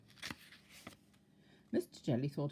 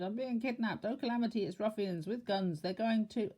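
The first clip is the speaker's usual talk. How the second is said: panicky; 7 semitones higher than usual. Acted. Panic over leaf.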